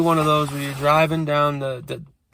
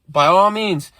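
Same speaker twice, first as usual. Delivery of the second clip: louder and higher, hitting "all" in "By all means"; mocking echo; 5 semitones higher, 5 dB louder than usual. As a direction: throw "By all means" back at her, sarcastically. He throws her words back at her.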